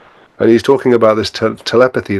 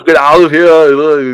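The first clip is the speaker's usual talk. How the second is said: loud, slow action-hero impression; hammed-up drawn-out action-hero order; Arnold Schwarzenegger; 6 semitones higher, 6 dB louder, much slower than usual. Client expelling the thing inside, loud mock action-hero command. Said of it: "Arnold Schwarzenegger".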